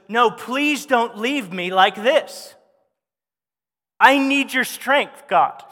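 slow, pleading, voice rising on the need; desperate plea. Acted. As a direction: beg for your life, not demand. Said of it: "He was begging for his life".

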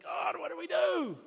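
high, loud, slow cry to the heavens; desperate shout. Retold or imitated. Up at midnight, yelling at God for an answer, desperate shout.

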